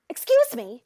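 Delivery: loud, pushy attention-getter; entitled complainer. Retold or imitated. Still-angry customer at the front desk, entitled. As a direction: demand attention at the counter, loudly. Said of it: "a lot of care and energy".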